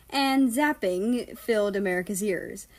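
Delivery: ordinary delivery, nothing marked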